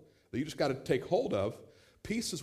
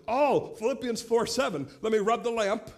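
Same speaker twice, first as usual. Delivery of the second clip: higher, louder needy prayer rubbing the lamp; his younger self wanting quick fixes from God; mock prayer-genie plea; 5 semitones higher, 5 dB louder than usual. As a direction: beg God like a genie, hammed up. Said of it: "I'd be like".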